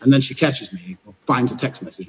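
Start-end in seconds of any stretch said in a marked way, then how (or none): none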